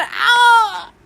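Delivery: loud, high drawn-out scream; mock scream. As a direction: let out a meme scream. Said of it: "I'm gonna stop screaming now".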